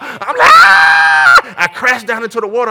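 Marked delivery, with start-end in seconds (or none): 0.5-1.5 very high, piercing mock scream; comic shriek of terror; childlike scream. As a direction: scream like a little child, full out. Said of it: "I'm screaming like a little child".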